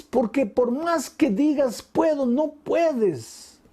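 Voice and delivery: high, impassioned preaching; preaching at full force. Acted. Preacher presses the congregation, high and impassioned. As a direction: drive the point home to the congregation. Rising sermon appeal.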